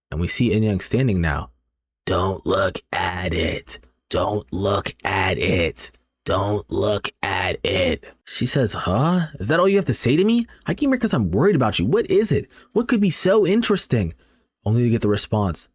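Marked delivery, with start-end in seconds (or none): 2.1-8.1 very slow, evenly spaced creepy warning; eerie monotone. Acted. Possessed girl warns her friend off the phone, eerie monotone. 8.7-14.1 high, quick exasperated questions; acted indignation. Worried friend confronts the girl on her phone, acted indignation.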